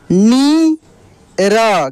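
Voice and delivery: long rising नी, pause, then short falling र; drawn-out syllables. Shown as a demonstration. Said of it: "खींच कर किया जाता है". (नी खींच कर, फिर र)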